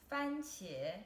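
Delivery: 番茄 said slowly and clearly, fān high and level, then qié rising; teacher to viewers, spelling out 番茄 first tone then second tone, careful demo; Mandarin tones demo. Shown as a demonstration. Model 番茄 with a flat high fān and a rising qié. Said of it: "The first two characters are pronounced 番茄".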